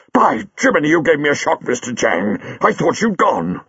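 high, startled and breathless. Acted. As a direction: jump at the shock. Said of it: "His heart gave a great leap, and he caught his breath".

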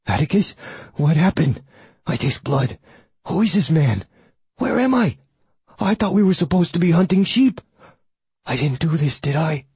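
high, confused hound voice; a worried, eager dog asking; big dog voice. A narrator's reading. Oberon the hound speaks.

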